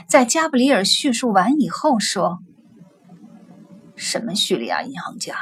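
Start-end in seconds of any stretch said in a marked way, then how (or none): 4.0-5.3 whisper. A narrator's reading. Feigned ignorance whisper.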